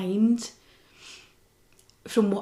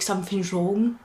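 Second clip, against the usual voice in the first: normal talking voice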